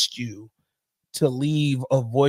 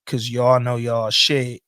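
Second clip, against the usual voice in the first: ordinary talk, nothing marked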